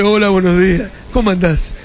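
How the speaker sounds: low, put-on manly greeting; man's voice. Host answering his woman friend's greeting in a deep put-on man's voice. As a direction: drop the voice to sound like a man. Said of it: "Puse voz de hombre".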